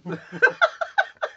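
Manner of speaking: very high squeal of laughter. Real, not acted. Recalling his mom finding him in the bathroom, very high squealing laugh.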